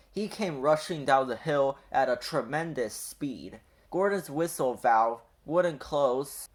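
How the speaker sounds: usual speaking voice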